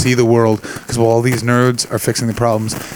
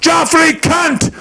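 put-on drunk yelling; much slower than usual. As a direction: bellow abuse down the street. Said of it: "a big man came out of Bannerman's and just went".

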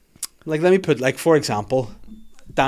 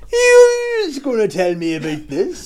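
high, slow mock uncle; drawn-out accusing uncle; Uncle Andy voice; 9 semitones higher, slower than usual. Uncle Andy pops out, quoted.

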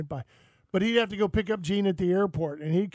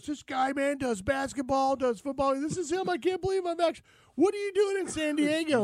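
starstruck fan; 8 semitones higher than usual. Retold or imitated. Fan voiced in retold story.

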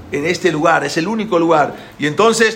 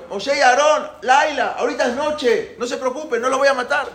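high-pitched calling voice; 9 semitones higher than usual. Acted. Pharaoh tells the hidden brothers not to worry, calling out. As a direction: call high, coaxing them out. Pharaoh calls them again.

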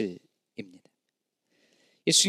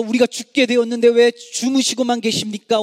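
desperate cry; 5 semitones higher, 5 dB louder than usual. Acted. Disciples' cry voiced in sermon.

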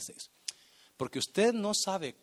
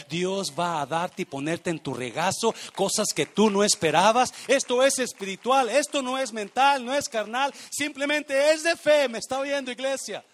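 preaching shout; 7 semitones higher, 11 dB louder than usual. Real, not acted. Pastor preaching to the church, building to a loud shout. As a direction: voice climbing higher and louder to a full shout.

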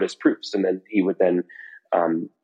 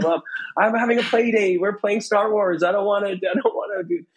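mock kid yelling. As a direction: wail at mom like a put-out kid, hammed up. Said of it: "I'm yelling".

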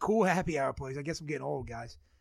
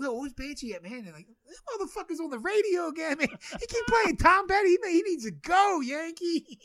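very high, loud whiny complaint; mock annoyed host; 13 semitones higher, 7 dB louder than usual. Party hosts in Queens griping about him on the radio, high and whiny. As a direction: whine like a fed-up party host.

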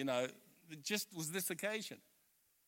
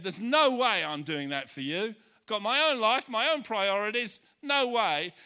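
defiant refusal; 5 semitones higher, 10 dB louder than usual. Retold or imitated. Second son refusing his father.

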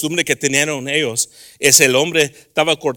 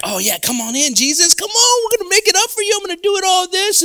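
much higher, bubbly host voice; eager welcome; 5 dB louder than usual. Acted. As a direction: gush over the guest, all sweetness. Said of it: "We're like".

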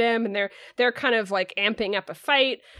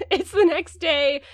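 laughing; 6 semitones higher, 4 dB louder than usual. Real, not acted. Laughs starting a new recap bit.

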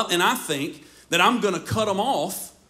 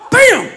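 loud, high imitated bang; loud bam; 11 dB louder than usual. Imitating the bat hitting the truck, loud bam. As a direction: smack it like a bat on metal.

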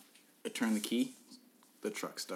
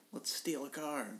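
softer, higher mock-sly pitch; hushed suggestion; 5 semitones higher, 6 dB quieter, slower than usual. Suggesting a joke crime to a friend, hushed. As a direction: float it quietly as a joke.

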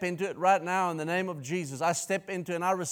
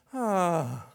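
imitating a parrot's groan. Preacher mimics his parrot copying his groan. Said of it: "in my voice".

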